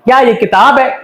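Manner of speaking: "क्या ये किताब है" lifted at the end as a question; questioning tune. Shown as a demonstration. Teacher showing learners a spoken question, questioning tune. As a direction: ask "क्या ये किताब है" with a rise on "है". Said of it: "अपने लहजे से".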